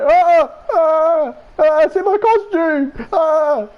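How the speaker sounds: high, drawn-out panicked wailing; mock panic. Man in monkey costume yells for help, mock panic. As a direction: wail in hammed-up alarm.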